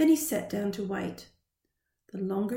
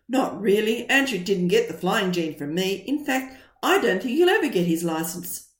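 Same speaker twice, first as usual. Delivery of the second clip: dismissive dad; 2 semitones lower, 6 dB louder than usual. Retold or imitated. Imagined dad dismissing his son.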